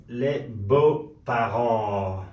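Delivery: les beaux parents stretched out very slowly, every syllable apart; very slow French demo. Shown as a demonstration. Teacher stretching the French phrase so students can repeat it.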